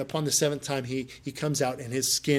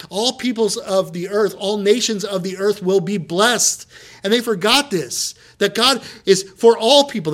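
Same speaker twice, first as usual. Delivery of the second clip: preaching at full force; 4 semitones higher, 5 dB louder than usual. Acted. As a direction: preach it to the whole room with rising fire.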